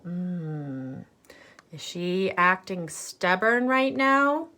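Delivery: usual speaking voice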